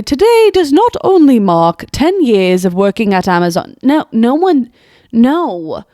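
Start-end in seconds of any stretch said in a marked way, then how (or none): 0.0-3.8 put-on British-Australian mock reading; British-Australian accent. Commentator insisting no fourth grader writes this, mock British-Australian accent. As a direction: mimic the letter in a hammed-up British-Australian accent. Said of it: "It was a mix of British".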